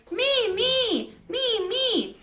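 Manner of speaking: high squeaky mock hoot; owl-like squeak. A narrator's reading. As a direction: echo the owl's call in a tiny voice. Hamster imitates owl call.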